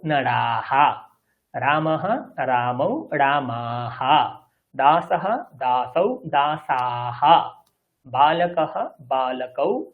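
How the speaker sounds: each plural stretched slowly, ending "আহা" drawn out; drill recitation. Shown as a demonstration. Teacher reciting noun forms as a model, slow endings. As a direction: recite the forms, slowing on each last word. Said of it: "আপনারা স্পষ্ট বলতেও পারবেন".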